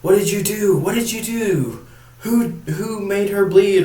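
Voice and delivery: hammed-up high cry of grief and anger; anguished outcry. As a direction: imitate the grieving boyfriend. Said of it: "where he's like".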